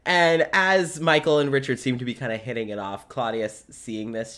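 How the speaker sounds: ordinary talk, nothing marked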